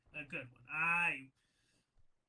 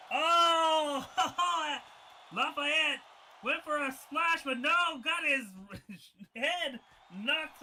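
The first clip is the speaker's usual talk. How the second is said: loud, higher play-by-play burst; excited call; 4 semitones higher, 5 dB louder, slower than usual. Real, not acted. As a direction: call the splash like a hyped commentator.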